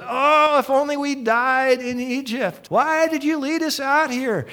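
high, loud, whiny wail of complaint; hammed-up whiny lament; whining Israelites. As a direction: moan like spoiled grumblers. Israelites wailing to Moses.

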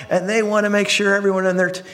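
usual speaking voice

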